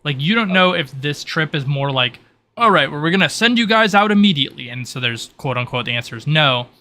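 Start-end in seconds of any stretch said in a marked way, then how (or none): 2.6-4.7 raised, louder voice of someone dispatching the group; brisk order to the party, higher and louder; announcing send-off. Acted. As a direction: pitch it up and call it out. Voices a leader sending them out.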